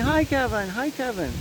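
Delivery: high-pitched imitation of an eager hello; excited greeting. Woman on the TV waving at Kevin, imitated eager greeting. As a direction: mimic someone calling a cheery hello.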